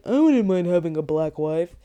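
lightly voiced quote of the man; imitating him. Quoting the man from the van ride, imitating him. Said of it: "when he was saying".

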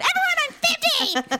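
very high, strained public announcement; mock announcement. Joking as a giant orange road cone, high mock announcement. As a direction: call it out high like a warning.

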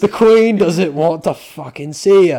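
slow, loud hammed-up British accent; mock British accent. Guard telling tourists off, slow mock British accent. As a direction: growl it out like a cockney bouncer in a British accent. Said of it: "British things to say".

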